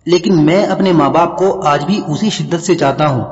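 plain reading voice

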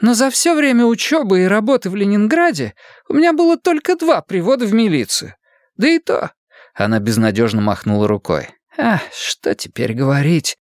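lightly voiced affronted girl; offended girl. Sofia's figure voice.

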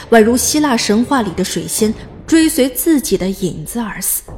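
usual speaking voice

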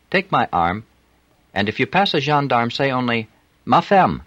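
a flirty French streetwalker; French accent. A narrator's reading. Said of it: "unmistakable French accent".

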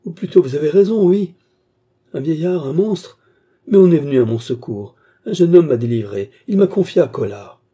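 high, loud, frantic protest; crying out. A narrator's reading. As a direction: deny it desperately, then cling to hope. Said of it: "s'écria Cerise". (protestation affolée, aiguë et forte)

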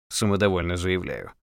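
plain reading voice

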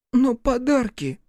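very slow, high sniffle; sniffling boy. A narrator's reading. Scolded boy admits to Рух with a high sniffle. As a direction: sniff it out sheepishly.

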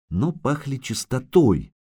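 usual speaking voice

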